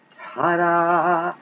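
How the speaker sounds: bright showman's ta-da; triumphant ta-da. Real, not acted. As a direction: announce it like a magic trick reveal. Reveals the card's matching message.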